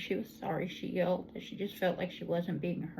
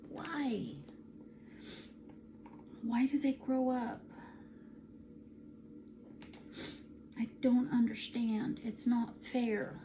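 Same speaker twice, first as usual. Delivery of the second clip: higher, playful whining lament; mock whine; 5 semitones higher, 4 dB quieter, slower than usual. Aunt mock-whining to viewers that nephews grow up. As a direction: pout it like a kid complaining.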